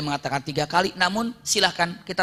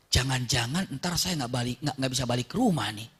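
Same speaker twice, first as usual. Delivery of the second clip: low, soft worried musing; inner thought; 6 semitones lower, 5 dB quieter than usual. Acted. Suraqah fears he won't get home, hushed inner thought. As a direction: voice a private doubt under the breath. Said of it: "ini dalam hati".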